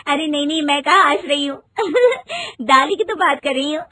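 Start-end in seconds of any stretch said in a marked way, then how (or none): 1.8-2.5 high rising giggle; quick bright giggle; bride's happy giggle. Acted. Rinki giggles.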